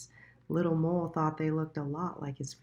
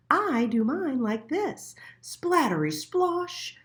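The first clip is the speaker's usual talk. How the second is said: high, loud and proud; showing off with a splash; pigeon voice; 10 semitones higher, 4 dB louder, slower than usual. A narrator's reading. The pigeon showing Little Mole its own way, high and proud.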